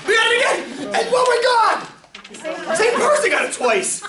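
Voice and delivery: high, thrilled exclamations with laughter; giddy, amazed, voice way up; excited yelling. Real, not acted. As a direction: shriek with delight at the luck. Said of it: "Sorry, I'm yelling".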